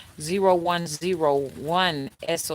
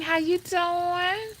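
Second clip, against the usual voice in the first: sing-song greeting; 12 semitones higher, slower than usual. Real, not acted.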